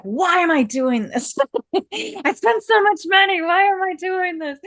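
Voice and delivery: half-laughing at her own folly; laughing disbelief. Retold or imitated. Builder mocking her own spending, laughing at herself. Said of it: "And I'm like".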